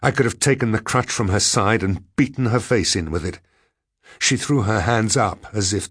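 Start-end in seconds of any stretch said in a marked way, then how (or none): none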